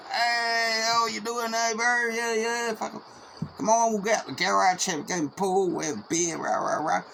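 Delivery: high, loud mock welcome from the mates; boisterous greeting. Mates greet the arriving biker, boisterous, high and loud. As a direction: holler a rowdy hello, then rattle off the plans.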